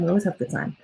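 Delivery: quiet sing-song afterthought; soft repeat. Real, not acted. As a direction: let it drop to a soft, private echo. Repeats line, then softer take.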